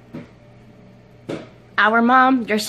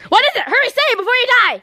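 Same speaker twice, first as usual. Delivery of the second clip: rushed and high, pleading; urgent; 9 semitones higher, much faster than usual. Acted. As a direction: hurry him before he dies. Urging a dying man.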